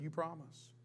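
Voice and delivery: soft, low, doubtful question; hesitant hushed boy. Acted. Little boy asking the snake, quiet and unsure. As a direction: ask quietly, half-trusting.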